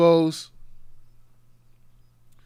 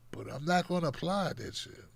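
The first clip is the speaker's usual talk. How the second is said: whispered aside; under-breath whisper; 3 semitones lower, 12 dB quieter than usual. Real, not acted. Host whispering a cheeky aside about vote shaming. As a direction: let it slip under your breath.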